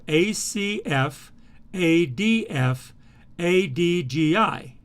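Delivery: slow, letter by letter "A D F", "A D G I"; slow dictation of the path letters; slow lettered read-out. Shown as a demonstration. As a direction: spell "A D F" and "A D G I" out slowly for the class. Reads path letters off board.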